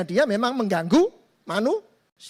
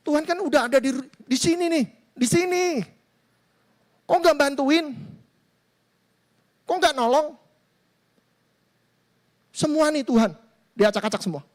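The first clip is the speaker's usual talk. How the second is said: higher pitched, accusing whine at God; indignant complaint; 6 semitones higher than usual. Acted. Believer protests to God over his wrecked heart, indignant. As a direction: demand to know why He did not help.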